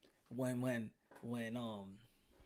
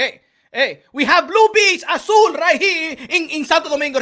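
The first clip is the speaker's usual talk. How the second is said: put-on loud Dominican-accented rant, higher; proud Dominican defending his country's beaches, loud; 10 semitones higher, 12 dB louder than usual. Dominican alter ego mocking Cleveland, loud accented rant.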